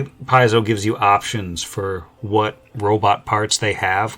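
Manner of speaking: usual speaking voice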